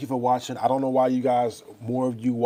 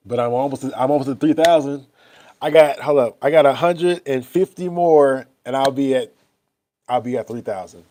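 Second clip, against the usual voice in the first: louder, higher, excited announcement; 4 semitones higher, 11 dB louder than usual. Real, not acted. Announcing he nearly has three thousand followers, excitedly and louder. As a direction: burst out about the milestone. Excited about subscriber count.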